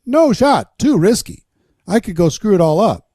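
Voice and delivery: ordinary talk, nothing marked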